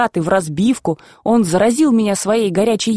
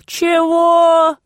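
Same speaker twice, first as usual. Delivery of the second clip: long, much higher, stretched word; drawn out; 9 semitones higher than usual. A narrator's reading. Grandfather to grandson, sly high drawn-out question. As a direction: drawl it out slyly and high. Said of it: "мигая, протянул".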